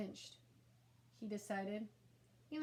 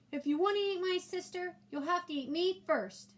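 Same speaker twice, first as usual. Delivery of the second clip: put-on much higher, louder heroic kid voice; brave defiance; 9 semitones higher, 7 dB louder than usual. A narrator's reading. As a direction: stand up bravely for her. Ian bravely defends sister.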